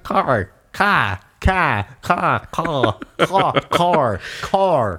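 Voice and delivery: "car" repeated, drifting between "cah" and "car"; dazed repeats of "car", often as "cah"; car with R slipping. Retold or imitated. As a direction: practise "car" over and over, losing the R. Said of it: "saying car".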